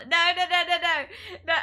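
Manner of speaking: high, loud run of refusals; loud protest. Real, not acted. Streamer reacting to a chat message, loud protest. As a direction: refuse loudly, pitched right up.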